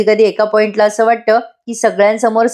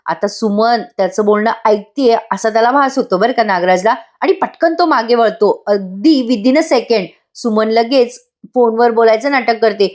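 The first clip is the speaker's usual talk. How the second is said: usual speaking voice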